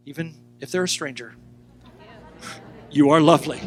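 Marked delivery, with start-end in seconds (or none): 2.9-3.6 said out loud and strong; declaring to a neighbour. Shown as a demonstration. Congregation tells a neighbour, loud and strong. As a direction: proclaim it to the person beside you. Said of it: "Tell the person next to you".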